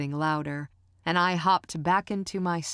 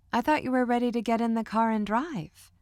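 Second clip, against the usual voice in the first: higher, playful teasing with a smile; 7 semitones higher, 3 dB louder than usual. A narrator's reading. Mother teasing her son about wanting to drive, smiling. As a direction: tease him lightly with a smile. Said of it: "she said with a teasing smile".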